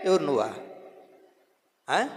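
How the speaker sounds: usual speaking voice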